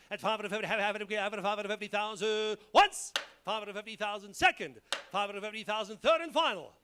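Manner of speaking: rhythmic auctioneer bid calling. Acted. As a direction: call going once, twice, sold. Auctioneer calling bids to close.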